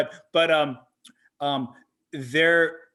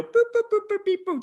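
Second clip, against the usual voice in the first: very high bouncy boops; bouncing sound effect; 4 dB louder, much faster than usual. Retold or imitated. Fan acting out a hat rolling out, playful sound effect. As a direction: imitate something tumbling along in squeaky little hops.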